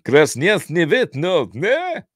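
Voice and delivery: mock Russian gibberish with a high "ne". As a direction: babble like bad movie Russian, ending on a high question. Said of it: "probably speaking as good Russian as me".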